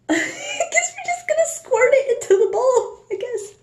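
high, squeaky laugh-talk; nervous laughing. Real, not acted. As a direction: talk through a rising, helpless laugh. Nervous laughing while mixing the dye.

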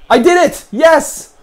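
high, loud victory cheer; triumphant. Real, not acted. Player cheering his move, excited shout. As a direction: celebrate the win. Gamer celebrates success.

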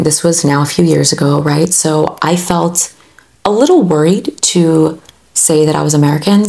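usual speaking voice

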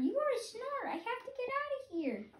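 very high, scared chick; a chick wanting to flee; baby bird voice, alarmed. Acted. Baby bird trapped by the snort, high and alarmed. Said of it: "my baby bird voice".

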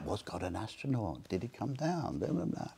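soft mock student chatter; hushed imitation. Students up in the middle of the night, hushed. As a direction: murmur it like late-night debating.

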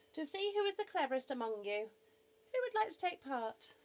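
high, loud announcement to a crowd; Noah addressing the animals. Acted. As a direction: put the question brightly to the whole assembly.

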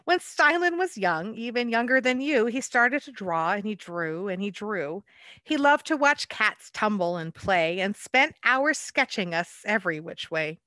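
lively picture-book read-aloud; story narration. A narrator's reading. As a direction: read it like a bedtime rhyme to children.

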